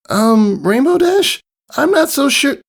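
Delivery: high, hesitant small voice; nervous young filly. A narrator's reading. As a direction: voice the scared little filly.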